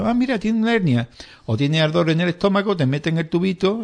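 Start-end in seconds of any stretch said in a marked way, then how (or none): 0.0-1.1 lightly voiced doctor, higher and casual; mock doctor. Doctor telling the patient about the hernia, mildly surprised. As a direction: play the doctor finding it by chance. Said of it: "y dice".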